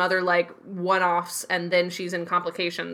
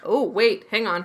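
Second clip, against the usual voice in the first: ordinary delivery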